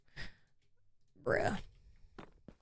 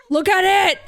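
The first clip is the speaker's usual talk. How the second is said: excited; 7 semitones higher, 7 dB louder than usual. Real, not acted. Repeats it to the kitten.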